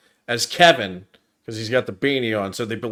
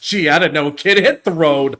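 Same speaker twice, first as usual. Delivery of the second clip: louder, higher mock-annoyed brush-off; annoyed driver; 5 semitones higher, 7 dB louder than usual. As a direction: imitate a driver shooing off a pestering kid. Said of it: "the driver is annoyed at his questions".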